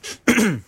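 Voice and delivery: high nervous throat clear; awkward cough to break the pause. Acted. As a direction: clear the throat before speaking. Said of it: "Er räusperte sich".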